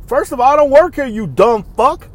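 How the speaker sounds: loud, high, indignant comeback; worked-up mock telling-off; retold angry retort. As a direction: act out snapping back at a rude shopper. Retelling own angry retort to shopper.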